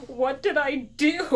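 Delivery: loud, high plea of innocence; bewildered protest. Retold or imitated. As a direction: act out a wronged kid protesting. Child protests being yelled at.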